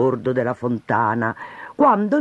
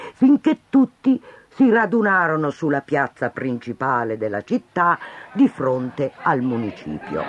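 ordinary delivery

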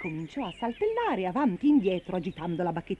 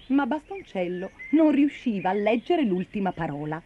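usual speaking voice

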